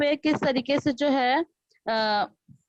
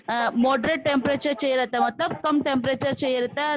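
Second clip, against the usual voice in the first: normal talking voice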